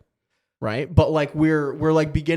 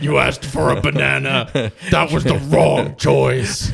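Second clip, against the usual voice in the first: slow, louder deadpan scolding; mock stern parent; 7 dB louder, slower than usual. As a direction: lay down the law, hammed-up. Playing stern parent over bananas.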